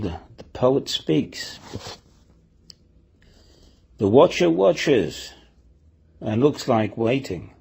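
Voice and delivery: slow, weighty poem reading with long pauses; poetry recital. Acted. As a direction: recite it line by line, very slowly.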